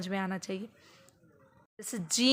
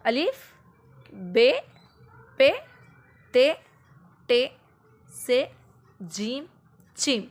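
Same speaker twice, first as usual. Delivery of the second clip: each letter called out with a rise; revising letters aloud with a child; loud letter names; 2 semitones higher, 5 dB louder, slower than usual. Shown as a demonstration. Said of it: "restart करना है बच्चे के सामने".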